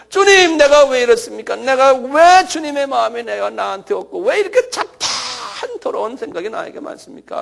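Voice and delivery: loud acted lament, easing toward the end; crying out in prayer. Shown as a demonstration. Preacher acting a kneeling penitent, crying out in prayer. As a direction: plead aloud to God, strongest on the opening call. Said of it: "한번 소리도 좀 지르고".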